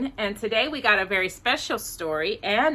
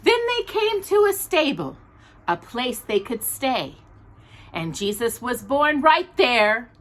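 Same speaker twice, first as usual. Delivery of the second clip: gentle rhyming storybook read; reading to children; 2 semitones higher than usual. Acted. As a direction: read the picture book aloud to little listeners. Reads a Christmas storybook aloud.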